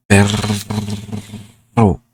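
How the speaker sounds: perro with a trilled double R; perro rolled hard in Spanish. Shown as a demonstration. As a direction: roll the rr in perro. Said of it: "I can say it with regular words".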